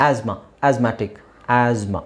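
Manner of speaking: "ಆಸ್ಮಾ" and "ಆಸ್ಮ್ಯಾಟಿಕ್" with a buzzing Z, no T; low, careful "az-ma", "az-matic"; silent TH. Shown as a demonstration. Teacher modelling the correct asthma, silent TH. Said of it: "this is the correct pronunciation".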